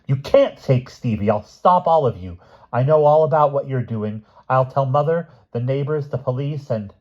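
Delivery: high, loud, urgent protest; frantic threats. A narrator's reading. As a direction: fight them off with threats. Lynn threatening them, fighting for Stevie.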